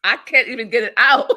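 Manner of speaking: cracking up mid-sentence; laughing too hard to get it out. Real, not acted. Guest laughing at host's phrase, laughing helplessly. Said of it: "I don't even know how to say that".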